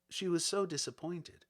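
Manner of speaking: plain reading voice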